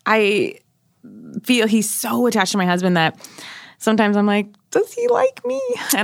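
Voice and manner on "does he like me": insecure whine. Retold or imitated. Quoting her own insecure thought.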